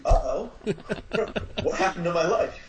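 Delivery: a hint of comic panic; a mock alarmed uh-oh; mock dismay. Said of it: "looked up a month later and said".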